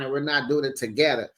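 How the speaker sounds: ordinary delivery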